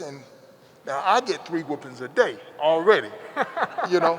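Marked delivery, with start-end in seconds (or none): 3.1-3.8 quick high chuckle at his own story; a short amused laugh. Real, not acted.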